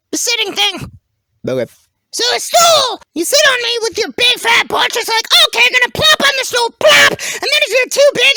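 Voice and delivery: very high, animated cartoon voice; excitable talking stool. Stool telling how it gets sat on, high excitable voice. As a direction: act out a hapless squeaky-high stool.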